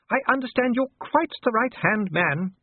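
high, gushing duchess voice; trilling Sybil. A narrator's reading. Duchess flattering the dwarf official, trilling. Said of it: "she trilled".

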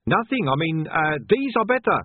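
high, flustered; nervous cover. Acted. Sweating pieman covering his mistake, high and flustered. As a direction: blurt it out, rattled.

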